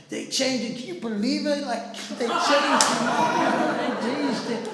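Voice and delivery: high, loud disbelieving cry through laughter; shocked amazement. Retold or imitated. Passenger who couldn't believe it, mock shocked exclaiming. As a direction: imitate a stunned onlooker, cracking up. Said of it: "he was, like, shocked".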